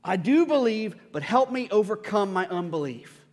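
acted heartfelt outcry; crying out. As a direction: cry out for help as the father. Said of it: "the father instantly cried out".

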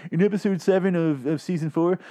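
high-pitched pedantic fanboy voice; convention nerd. Acted. Fan at a convention querying continuity, nerdy and pedantic. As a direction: nitpick haltingly like an obsessed fan. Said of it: "a guy that goes to a convention".

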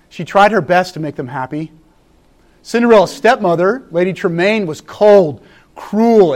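ordinary talk

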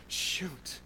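unvoiced, quiet oops; whispered curse. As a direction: whisper it like a slip. Admitting a mistake to oneself.

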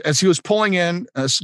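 ordinary talk, nothing marked

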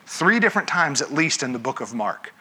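ordinary delivery

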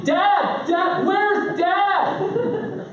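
put-on very high, sing-song child's holler; insistent kid calling. Kid with endless energy seeks dad out, high mock holler. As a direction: imitate a relentless kid searching for Dad.

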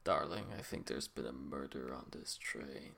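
low, hushed detective murmur; Detective Train. Acted. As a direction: murmur it very low and softly, like a secretive sleuth. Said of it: "That's how he talks".

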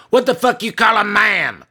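shrill high furious shout; drunk rage. Acted. Tiny drunk woman snapping at the manager's ma'am. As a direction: spit it out full of drunken spite.

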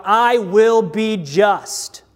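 slow, weighty proclamation with each word spaced out; deliberate, grave voice of God; God's solemn declaration. Acted. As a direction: give every word its own heavy beat. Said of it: "looks at rebels and cries out".

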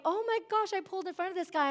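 high, bright gasp of self-reproach; mock dismay. Preacher mimics the contrite reaction she should have had, high and alarmed. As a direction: squeal it like you just realized your mistake. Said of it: "instead of me going".

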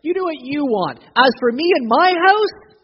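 loud, high, defiant proclamation; Joshua's bold declaration. Retold or imitated. Joshua telling the people, loud and defiant. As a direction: throw it out like a challenge to the people. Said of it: "This is like Joshua".

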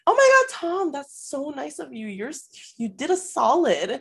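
high mock-adoring girl; hammed-up impressed reaction; over-the-top gushing. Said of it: "so over the top in reacting".

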